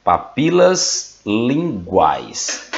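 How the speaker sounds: papilas linguais very slow and spaced; slow dictation. Shown as a demonstration. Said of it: "pra vocês copiarem".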